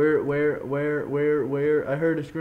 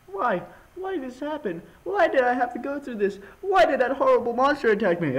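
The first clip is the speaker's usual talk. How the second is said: distressed game character; 8 semitones higher than usual. Acted. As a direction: plead for a reason, high-pitched.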